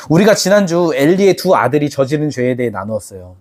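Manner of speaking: normal talking voice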